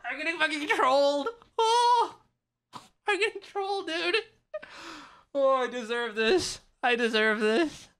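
high, giddy laughing complaint; real exasperated laughing; laughing disbelief. Real, not acted. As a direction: laugh it off while tilting. Laughing at being trolled.

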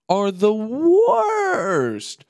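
each word stretched slow, high and loud; a disgusted verdict dragged out for effect; drawn-out emphasis. Real, not acted. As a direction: lean hard on every word. Ranting that iPhones are the worst.

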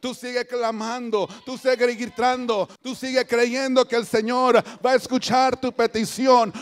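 loud exhortation building in force; preach. Real, not acted. (exhortación fuerte, cada vez más intensa)

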